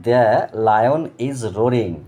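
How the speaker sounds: "lion is roaring" stretched out word by word, each word drawn long; slow teacher reading; very slow model sentence. Shown as a demonstration. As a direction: spell out "lion is roaring" slowly for learners. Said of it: "মানে the".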